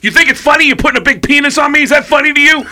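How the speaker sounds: high, loud mock outrage; angry. Drummer phoning the tattoo shop, furious comic shouting. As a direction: shout the accusation down the phone.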